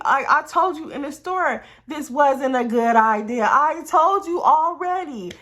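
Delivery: scold, stretching out the last reminder; exasperated scolding. Retold or imitated. Storyteller replays the quarrel after the crash, exasperated scolding.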